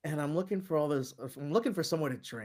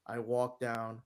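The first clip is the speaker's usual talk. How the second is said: ordinary delivery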